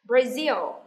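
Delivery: "Brazil" loud, as bra-ZIL; stress on second syllable. Shown as a demonstration. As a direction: model "Brazil" with a strong, falling second syllable. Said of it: "nhấn vần thứ hai".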